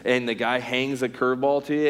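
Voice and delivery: ordinary talk, nothing marked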